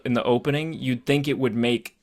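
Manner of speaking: usual speaking voice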